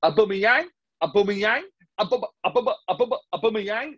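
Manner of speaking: mock hysterical fan chanting the name; mock ranting fan. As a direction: imitate a fan losing it over a player. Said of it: "some ridiculous rant from me".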